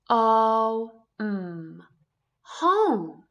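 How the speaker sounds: teacher sounding out each sound, then the word; sounding out home. Shown as a demonstration. Teacher blending sounds for young readers, stretched sounds. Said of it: "So flipping the vowel helped us to figure out that word".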